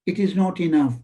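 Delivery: normal talking voice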